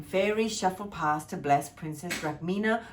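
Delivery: ordinary delivery, nothing marked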